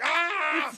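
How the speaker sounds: very high-pitched comic scream; hammed-up cry of alarm, way up high; mock scream. [name] reacting to Rick's news, high mock scream.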